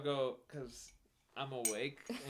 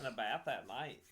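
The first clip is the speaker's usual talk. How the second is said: put-on Wisconsin accent. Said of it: "Wisconsin-y".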